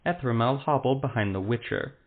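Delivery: ordinary delivery, nothing marked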